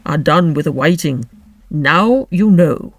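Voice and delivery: grave, deliberate Griffin; deep voice. A narrator's reading. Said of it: "in a deep voice".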